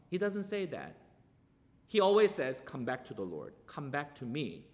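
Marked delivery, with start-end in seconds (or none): 2.6-4.6 much lower and softer, a gentle call home; hushed, pleading invitation; tender plea. Acted. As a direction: coax them back gently like a loving father. God pleading with his people.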